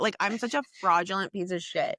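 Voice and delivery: usual speaking voice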